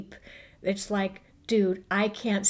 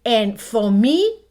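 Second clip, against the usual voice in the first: emphatic build; 6 semitones higher, 10 dB louder than usual. Real, not acted.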